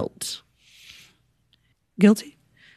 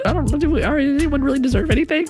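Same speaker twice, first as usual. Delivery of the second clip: flustered modesty; 7 semitones higher than usual. Retold or imitated. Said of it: "I was like".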